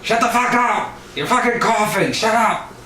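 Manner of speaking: high-pitched hammed-up outburst; Johnny's exasperated yelling. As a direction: mimic a roommate losing it over the noise. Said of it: "And Johnny hears it".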